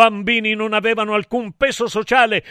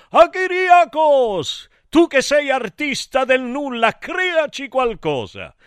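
high, drawn-out appeal; high-pitched summons, name stretched; calling out; 6 semitones higher than usual. Acted. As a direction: call up to a god with a plea. Gods summon him with a request.

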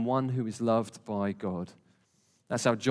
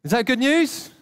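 rousing question; 11 semitones higher, 7 dB louder than usual. Real, not acted. Preacher calls out to congregation.

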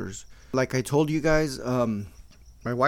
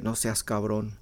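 softer repeat; 4 semitones lower, 5 dB quieter than usual. Shown as a demonstration. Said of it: "that's how they say it".

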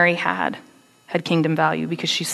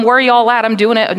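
loud, higher exasperated calling out; fed-up call; 5 semitones higher, 8 dB louder than usual. Retold or imitated. Mother calling out to her family, loud and fed up. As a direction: yell through the house for help. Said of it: "I've said this before in my own home".

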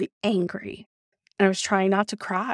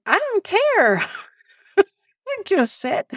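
high-pitched exasperation breaking into a laugh; retold indignant squeal with laughter; exasperated outburst; 11 semitones higher than usual. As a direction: blurt it way up high, half laughing. Retold outburst at her manager.

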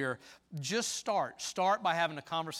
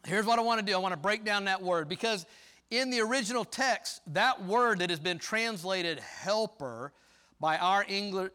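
louder, ringing sermon delivery; preaching; 3 semitones higher, 5 dB louder than usual. Acted. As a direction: press each point to the congregation. Preacher teaching from the pulpit.

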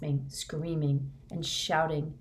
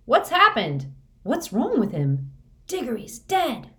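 high, alarmed shouted questions; panicked crowd cries; 7 semitones higher, 6 dB louder than usual. A narrator's reading.

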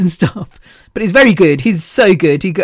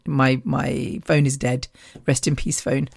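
mock mourning; 4 semitones lower, 7 dB quieter than usual. Mock mourning dead phone.

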